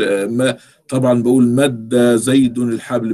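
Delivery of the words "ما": usual speaking voice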